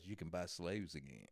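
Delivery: low, soft punchline delivery; confiding reveal. Retold or imitated. Woman finally reveals the secret, low and hushed. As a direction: drop way down to let out the secret.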